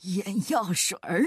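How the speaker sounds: low astrologer voice on the edge of laughter; incredulous mockery. A narrator's reading. Astrologer mocks eye drops for bellyache, incredulous. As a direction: repeat it as a ridiculous idea. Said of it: "星象家哈哈大笑".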